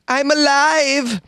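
put-on high, slow little boy declaring he's alive; eerie child saying he lives. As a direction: stretch it out like a spooky kid. Boy's quote, comic high voice.

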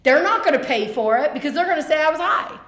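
loud, high, indignant rush of words; real exclaiming. Real, not acted. Answering whether she worried about the drug test, exclaiming. Animated answer to co-speaker's question.

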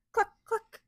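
two short, high clucks; hen clucks. Acted. Hens try waking the cows, high clucking.

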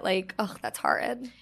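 quiet, dismissive that's horrid; softly disdainful. Shown as a demonstration. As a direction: murmur it with a little sniff. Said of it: "that way, the way you say it".

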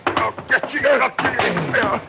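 angry. Acted. Thug fighting back.

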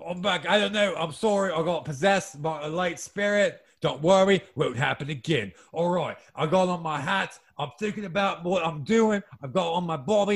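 apologetic mock-Cockney patter; chatty hammed-up Cockney; bad Cockney accent. Apologising for being possessed, mock Cockney. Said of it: "picking up the Cockney slang".

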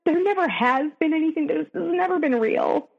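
upset, voice rising as the sadness returns; real sadness in voice. Real, not acted. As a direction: hold back tears while telling it. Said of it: "you could hear it in my voice".

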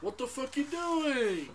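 slow, high, drawn-out mock yelling; imitating a TV cop yelling through laughter. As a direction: bark like a cop harassing a homeless guy. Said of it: "And he's just like".

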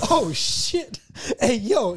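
mock a repairman calling over in amazement; amused shock. Repair guy calls his coworker over, amused shock. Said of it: "the worst part is he's laughing".

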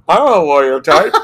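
recalling a tipsy boast with a laugh. Retold or imitated. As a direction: laugh at your own tipsy bravado.

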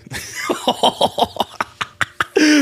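a high, breathless wheeze of a laugh; wheezing laugh. Real, not acted. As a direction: crack up at your own joke, silent and high. Big laugh at own joke.

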